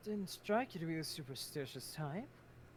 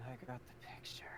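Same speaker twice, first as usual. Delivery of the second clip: weary whisper; 5 dB quieter than usual. Acted. Weary character reply.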